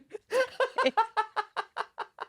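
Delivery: high, helpless giggling fit; long breathless laugh; helpless laughter. Real, not acted. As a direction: crack up completely. Cracks up again.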